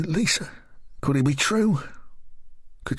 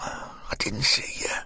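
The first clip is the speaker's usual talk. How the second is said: whisper; 5 dB quieter, slower than usual. Acted. Shaken after being startled.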